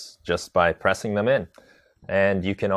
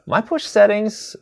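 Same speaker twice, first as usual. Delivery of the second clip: much higher, slow pickup of the topic; bright repeat; 5 dB louder, slower than usual. Shown as a demonstration. Streamer picks up viewer's chat question, much higher. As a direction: lift it up high and slow as the new topic.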